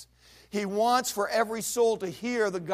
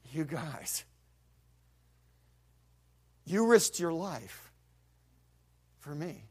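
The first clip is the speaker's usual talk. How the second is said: heartbroken; slower than usual. Retold or imitated. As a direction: say it choked up. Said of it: "his heart just broke".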